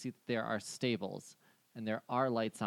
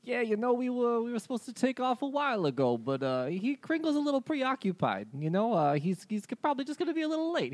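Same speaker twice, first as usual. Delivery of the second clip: higher, friendly reindeer voice; 11 semitones higher than usual. Acted. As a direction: play the chatty reindeer.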